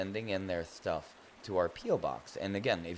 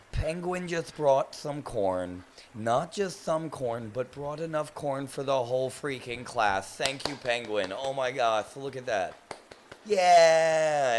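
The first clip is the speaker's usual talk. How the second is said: loud, amazed celebration; thrilled and loud, drawing words out; excited; 4 semitones higher, 8 dB louder than usual. Real, not acted. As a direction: announce it loudly, savouring every word. Celebrating Penguin's gift of corn.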